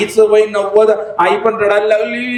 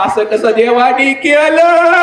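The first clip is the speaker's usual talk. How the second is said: slow, high singsong wail; mock keening that climbs to the end; wife's crying; 8 semitones higher, slower than usual. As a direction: lament like a widow, hammed up. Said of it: "तू रडायला लागली माहिती".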